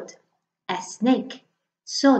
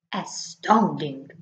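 slow lower Gruffalo; 5 semitones lower, slower than usual. Acted. Gruffalo stunned by the mouse, low and slow. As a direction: lower and slow, drawn out in awe.

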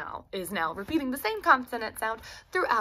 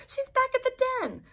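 mocking stepsister. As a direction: taunt it high. Doris laughing, lying.

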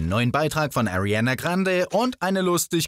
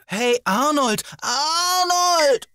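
calling out; 12 semitones higher, much slower than usual. Acted. Narrator calling to Arnold in the coffin, high calling out. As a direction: call to him across the distance.

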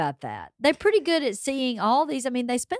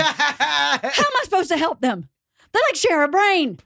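higher, baffled protest; mock exasperation; 6 semitones higher than usual. Husband asked to help two mumbling brothers, exasperated and higher. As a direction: complain like an overwhelmed son-in-law.

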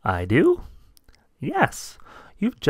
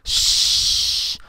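shushing; 3 dB louder than usual. Acted. Claude hushes Rudy.

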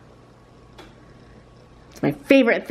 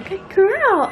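higher, sing-song praise; 9 semitones higher than usual. Real, not acted. Praising toddler.

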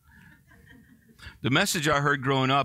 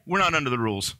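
ordinary talk, nothing marked

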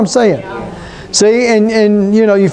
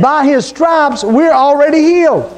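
higher-pitched, emphatic preacher's proclamation; raised-pitch sermon declaration; preaching; 5 semitones higher than usual. Real, not acted. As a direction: proclaim it to the congregation with conviction.

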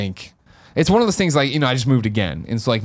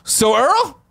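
higher and a bit louder, an awkward plea; sheepish asking; 10 semitones higher, 3 dB louder than usual. Retold or imitated. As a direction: ask a favour sheepishly and politely. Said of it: "I'm like".

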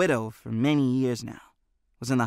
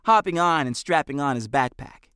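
plain reading voice

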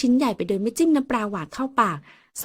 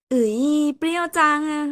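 sour face reaction; 6 semitones higher, 3 dB louder than usual. Acted. Lin tastes sour mango.

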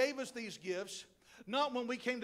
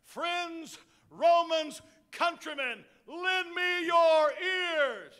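loud, slow declaiming orator; grand oration; 5 semitones higher, 7 dB louder, much slower than usual. Pretend. Preacher mocking a school speech, slow booming oration. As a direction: boom it out like Mark Antony to the class. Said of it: "standing up in front of your English class".

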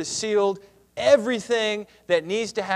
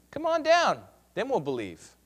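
mocking; 4 semitones higher than usual. Priests goad the man on the cross, mocking. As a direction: taunt that starts high, then drops. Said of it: "mocked him to one another".

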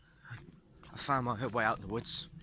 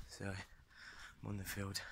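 quiet; 11 dB quieter, much slower than usual. Real, not acted. Person walking nearby, hushed.

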